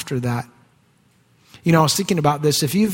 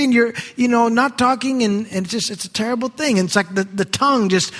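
ordinary talk, nothing marked

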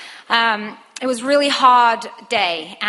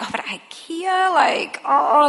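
mildly whiny, drawn-out reluctance, higher pitched; grossed-out protest; 5 semitones higher, slower than usual. Retold or imitated. Wife balking at husband's Swedish meatballs, grossed-out protest. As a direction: drag it out in mock disgust.

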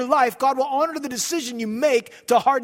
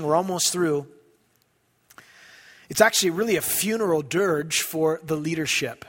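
ordinary talk

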